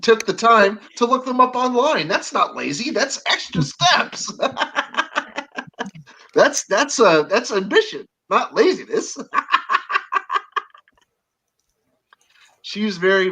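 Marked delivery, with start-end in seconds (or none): 2.9-4.3 high, slow, drawn-out tease; slow, teasing "extra steps"; teasing emphasis. Real, not acted. Teasing Crystal about being lazy. 4.3-5.9 high, quiet giggle. Real, not acted. Laughing at own teasing. 9.2-10.6 high, breathy giggle. Real, not acted. Laughing at own teasing.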